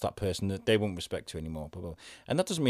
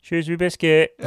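loud higher call across the water; shouted from a boat; 8 semitones higher, 8 dB louder than usual. Retold or imitated. A man on a boat shouts at a friend vlogging on the bridge, loud cheeky call. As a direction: yell it cheerfully from a passing boat. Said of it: "Duncan going".

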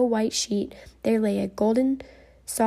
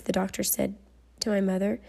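ordinary delivery

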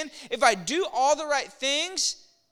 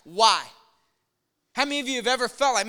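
ordinary talk

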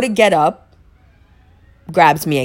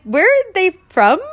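shocked question; 13 semitones higher than usual. Retold or imitated. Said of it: "She's like".

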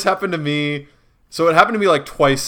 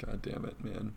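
whispered under the breath; hushed exasperated curse; 4 semitones lower, 19 dB quieter than usual. Retold or imitated. Whispered quote.

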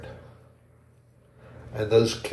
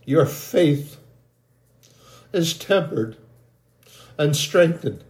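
higher, ringing prophetic proclamation; God telling believers they are forged in fire, proclaimed higher; 4 semitones higher, slower than usual. Acted. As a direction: speak as God blessing his people, rising. Prophetic word in God's voice.

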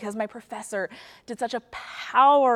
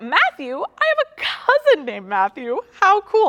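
higher and louder, gushing; childlike excitement; 10 semitones higher, 8 dB louder than usual. Retold or imitated. Pastor retelling her middle-school self finding Matthew, giddy. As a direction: squeal it like a delighted kid. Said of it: "and I was like".

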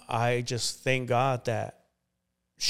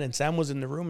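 ordinary delivery

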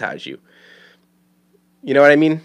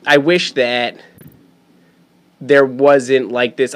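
usual speaking voice

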